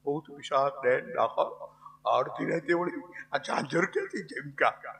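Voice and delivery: hushed, low voice of a scared watchman; frightened. Acted. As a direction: wonder under your breath, uneasy. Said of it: "ઓલો બીનો". (ડરેલો, દબાયેલો અવાજ)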